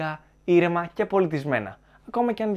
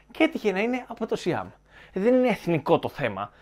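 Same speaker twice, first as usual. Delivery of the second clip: normal talking voice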